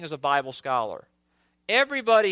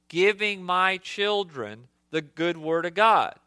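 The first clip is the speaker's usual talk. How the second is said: ordinary talk, nothing marked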